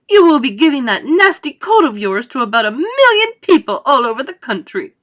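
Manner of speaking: high, loud scolding; shouted scolding. A narrator's reading. Mother shouts at her son about his germs, scolding. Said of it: "shouted Missus Gloop".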